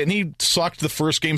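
ordinary talk, nothing marked

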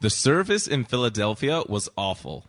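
American accent trying for Philadelphia; a light go at an American accent. Shown as a demonstration. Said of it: "Here's a boy saying it".

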